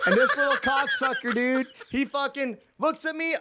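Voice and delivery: can barely hold the laugh in; laughing while telling. Real, not acted. Laughing as he tells his friend about the inmate, laughing.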